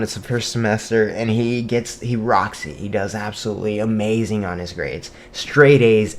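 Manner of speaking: normal talking voice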